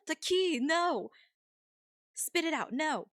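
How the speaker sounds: sharp high alarmed no; scolding pet. Real, not acted. Streamer scolds pet with the key in its mouth, loud and alarmed. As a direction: stop the pet chewing the key, loudly.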